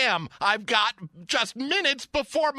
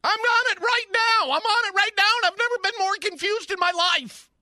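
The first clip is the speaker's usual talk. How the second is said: yelling at phone menu; 9 semitones higher, faster than usual. Acted. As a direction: snap back at the machine in disbelief.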